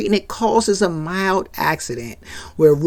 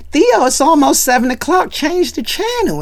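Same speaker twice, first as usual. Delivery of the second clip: put-on high kid's voice, pushy; whiny little sister wanting the TV; demanding kid; 9 semitones higher than usual. Kids demanding the TV.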